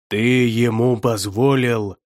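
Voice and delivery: slow, drawn-out growled question; angry growl. A narrator's reading. Ху Вэй demands an answer about the blood, growling. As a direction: growl out each word accusingly. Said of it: "рыкнул Ху Вэй".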